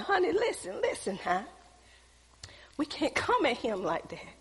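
imitating a quiet, calming plea; retold soothing hush. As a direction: gently talk down your husband, hushed. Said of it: "So lower your voice".

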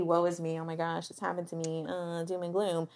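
soft, mock woe-is-me wallowing; self-pitying voice. Mocking self-pity while talking about sitting with feelings, self-pitying voice. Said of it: "don't know why I did that voice".

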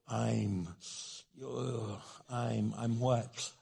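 low, slow, halting false starts; reluctant stammer. Acted. Husband struggling to apologize to his wife, slow reluctant stammer. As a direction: drag it out as if it won't come.

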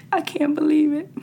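high, hammed-up astonishment; mock disbelief. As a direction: pretend to be floored.

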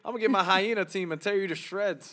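high, loud pretend threat; mock unthreatening lion threat. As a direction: imitate a threat that intimidates nobody. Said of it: "you didn't even sound aggressive".

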